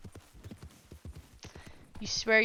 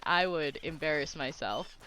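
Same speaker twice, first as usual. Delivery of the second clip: ordinary talk, nothing marked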